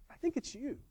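high, soft quoted voice; gently correcting wife. Retold or imitated. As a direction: imitate a wife breaking it gently. Retold wife gently corrects him.

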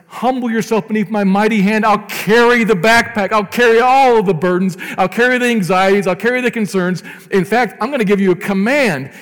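loud, high commanding God; loud and soaring, God commanding his people; voicing God. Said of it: "but God says".

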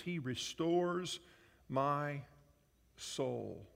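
slow scripture reading. Acted. Pastor to congregation, a slow reverent scripture reading. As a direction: read it slowly like a psalm.

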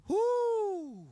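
a high, loud preacher's whoo; whoo exclaim. Real, not acted. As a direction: let out a long high whoo. Reacting after mock whine.

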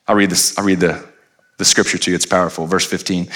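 ordinary delivery

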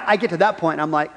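normal talking voice

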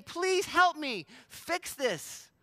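high, drawn-out fake plea; mock freak-out. Jesus imagined coming to us for help, mock panic. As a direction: beg for rescue in a hammed-up helpless voice. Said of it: "it's Jesus freaking out".

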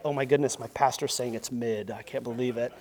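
low, soft mock disbelief; a hint of a scandalized listener; mock shocked churchgoer. Congregation member muttering in mock shock at pastor.

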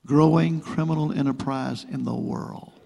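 ordinary talk

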